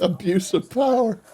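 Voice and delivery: mildly mocking the suspect's protest; mocking echo. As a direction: parrot the suspect's cry with light scorn.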